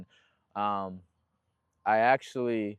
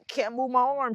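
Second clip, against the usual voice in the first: retold panic, pitched up; panicked; 10 semitones higher than usual. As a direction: plead in a high, scared voice. Telling mom he cannot move.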